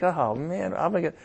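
quiet fretting thoughts before prayer; anxious inner worry. Retold or imitated. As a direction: mutter the worries softly to yourself. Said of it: "when I'm just feeling like".

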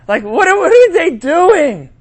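high incredulous scoff; mock disbelief; mock scoffer. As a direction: sneer at them. Imitating scoffers.

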